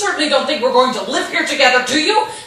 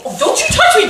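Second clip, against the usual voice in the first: sharp, raised voice pulling away; angry warning; 6 semitones higher, 3 dB louder than usual. Acted. Wife pulling away from her husband, angry and raised. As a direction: fend him off angrily.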